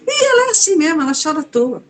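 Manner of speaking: high-pitched mock brush-off; retold dismissiveness. Mother tells driver about crying daughter, dismissive. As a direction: wave it away in a lifted, piping voice.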